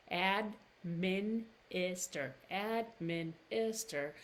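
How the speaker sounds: administer drawn out slowly, ad-MIN-is-ter; slow demo. Shown as a demonstration. Teacher slowly modeling administer. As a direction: stretch out administer. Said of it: "Tongue is back in my mouth".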